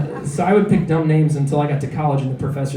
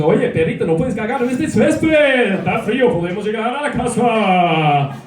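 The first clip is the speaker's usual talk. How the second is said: mock Spanish TV host talking to a dog; hammed-up announcer voice for the dog; Sábado Gigante voice to dog; 6 semitones higher than usual. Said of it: "a Sábado Gigante voice".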